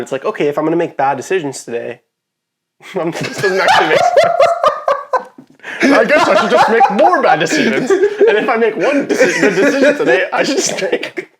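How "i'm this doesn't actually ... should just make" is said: high, cracking up with laughter; helpless giggling speech; laughing while talking. Real, not acted. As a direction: push the words out through a laughing fit. Cracks up over own bad advice.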